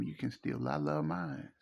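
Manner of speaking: ordinary talk, nothing marked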